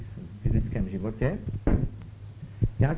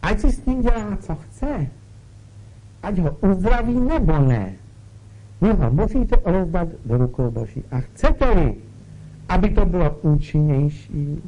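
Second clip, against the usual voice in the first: louder, urgent sermon push; forceful preaching; 2 semitones higher, 5 dB louder than usual. Acted. Preacher urges the listeners to surrender the sick, forcefully. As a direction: drive the point home to the congregation.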